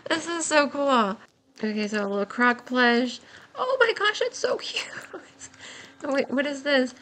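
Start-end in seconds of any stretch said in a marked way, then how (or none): 3.6-4.9 very high, laughing squeal; squealing delight. Real, not acted. Player finding a little croc plush, high squealing delight. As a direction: squeal as if melting with cuteness.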